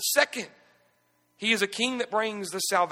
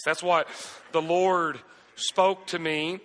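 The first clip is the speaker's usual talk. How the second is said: ordinary talk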